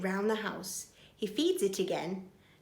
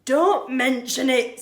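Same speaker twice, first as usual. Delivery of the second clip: gallant burglar; 4 semitones higher, 9 dB louder than usual. Acted. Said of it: "says Burglar Bill".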